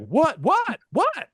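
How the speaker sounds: high, alarmed repeated what; jolted, panicky what what what; a nervous old broadcaster caught off guard; startled McCarver. Retold or imitated. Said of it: "He's like".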